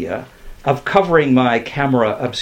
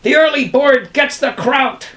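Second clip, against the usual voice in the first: high-pitched, eager character voice; high, rallying bird voice; excited bird pilot; 13 semitones higher, 4 dB louder than usual. A narrator's reading. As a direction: pipe it up to your crew. Bird pilot calling to colleagues.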